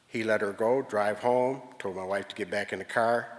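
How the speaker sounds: normal talking voice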